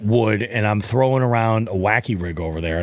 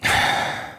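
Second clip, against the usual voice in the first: sigh. Retold or imitated.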